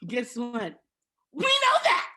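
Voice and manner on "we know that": much higher, louder exclamation; real exasperated outburst; exasperated shout. Real, not acted. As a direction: fling it out in disbelief. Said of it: "exasperated retort".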